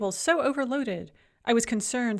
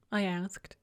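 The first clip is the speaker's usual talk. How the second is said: plain reading voice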